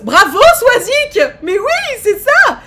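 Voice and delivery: bravo crié bien plus haut et fort, en riant; joie en riant. Real, not acted. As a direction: explose de joie pour Swazik. Félicite la bonne réponse. (hurle bravo à Swazik en riant)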